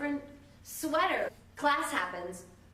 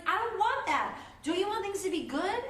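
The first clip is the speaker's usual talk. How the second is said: high, loud pleading question; earnest Elphaba; 10 semitones higher, 3 dB louder than usual. Retold or imitated. Elphaba appeals to Glinda, high and earnest. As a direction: appeal to her better side. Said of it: "Elphaba's like".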